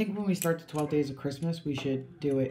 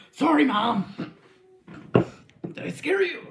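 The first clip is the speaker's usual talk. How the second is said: slow, high-pitched call to Mom; singsong shouted apology; 9 semitones higher, 3 dB louder, slower than usual. Real, not acted. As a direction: call across the house, stretched out and rising. Calls out to Mom nearby.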